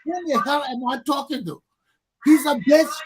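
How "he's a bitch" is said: loud, furious shout; a loud angry outburst. Real, not acted. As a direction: yell the insult in real anger. Angry rant about wigs.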